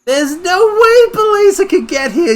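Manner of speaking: high and loud protest; shocked disbelief. Real, not acted. Confronting Belezo's arrival, loud disbelief. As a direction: insist it's impossible.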